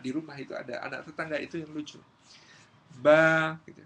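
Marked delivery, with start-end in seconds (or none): 3.0-3.6 loud, held single syllable; loud recitation of a beginner; child reading aloud. Acted. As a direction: belt out the letter.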